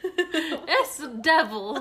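laughing through the words, slow. Real, not acted. Host laughing at her friend's devil joke. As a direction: crack up mid-sentence.

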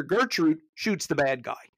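ordinary talk, nothing marked

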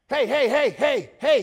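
loud, high, repeated calls for attention; frantic high-pitched hollering; urgent calling out. Acted. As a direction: call out to grab any passer-by. Beggar grabbing at passers-by.

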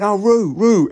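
shouting a dog's name into the dark; calling the dog. Retold or imitated. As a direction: call out for the lost puppy.

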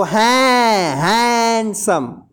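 "हैं" held very long, then "handsome" slowly stretched; drawn-out syllables. Shown as a demonstration. Teacher shows learners the break inside hand, syllable drawn out. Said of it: "hand में भी break हो जाएगा".

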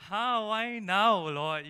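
high, loud protest to God; acted-out shocked complaint, falling away at the end; dismayed reaction. Young believer to God on hearing "go full time", dismayed protest. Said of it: "can you imagine my reaction".

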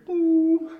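high, loud held tone; imitated PA chime. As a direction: mimic a loudspeaker signal before an announcement. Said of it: "the P A says".

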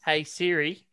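put-on Siri called in an Australian accent; Aussie accent. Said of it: "your Aussie accent's bullshit".

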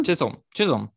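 Chisholm as CHIZ-um, short and falling; correcting pronunciation. Shown as a demonstration. Co-host confirming how to say his last name, demonstrated pronunciation. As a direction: repeat Chisholm, stressing the first beat. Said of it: "how do you say his last name?".

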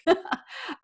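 short high chuckle; light laugh. Real, not acted. Amused at her remark about decent folk, light laugh. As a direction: laugh lightly at your own joke.

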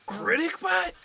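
high-pitched disbelieving question; shocked. Acted. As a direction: gasp the name in disbelief. Cook shocked at the name.

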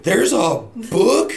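high, loud, slow astonished question; amazed kid. Retold or imitated. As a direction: gasp it out like a stunned ten-year-old. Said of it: "I was like".

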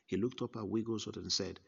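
ordinary delivery

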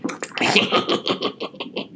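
soft evil snicker; a quiet villainous cackle; evil laugh. Acted. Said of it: "la risa malvada del tiburón".

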